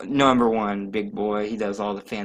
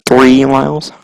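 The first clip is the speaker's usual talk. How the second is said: much louder, close-mic boom; booming close-mic announcer voice; right up on the mic; 13 dB louder than usual. Pretend. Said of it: "I'm so sorry for your ears".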